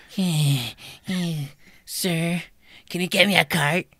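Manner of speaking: high, demanding customer voice calling for help; shrill Karen shriek. Retold or imitated. Retelling a store encounter, mimicking a demanding Karen. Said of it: "annoying shriek".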